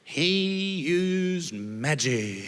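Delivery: very slow, stretched-out words; mock mystical. Mary selling her excuse to Joseph, slowly and grandly drawn out. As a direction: draw every word out as if revealing a wonder.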